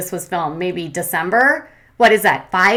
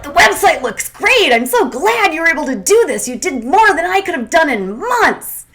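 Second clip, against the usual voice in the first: mock gushing; 8 semitones higher, 4 dB louder than usual. Tyler quoted, mocking gushing.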